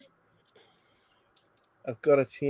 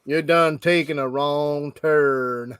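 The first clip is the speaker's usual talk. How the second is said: put-on Southern hillbilly accent. Quoting film hillbilly line.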